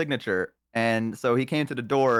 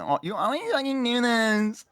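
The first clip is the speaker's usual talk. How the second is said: high, far put-on scolding voice; high mock-angry complaint; mock exasperated rant; 10 semitones higher than usual. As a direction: whine it like the running bit.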